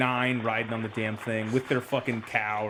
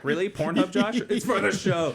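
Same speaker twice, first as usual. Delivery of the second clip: mock girlfriend; 9 semitones higher, 4 dB louder than usual. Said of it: "It's like".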